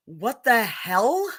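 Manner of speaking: baffled disbelief. Real, not acted. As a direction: stretch it out in real bafflement. Appalled at the mispronunciation.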